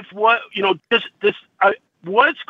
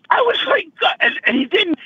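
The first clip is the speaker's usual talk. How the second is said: high, tearful catch on the words; fighting back tears mid-sentence; crying; 7 semitones higher than usual. Real, not acted. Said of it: "I'm sorry I'm crying".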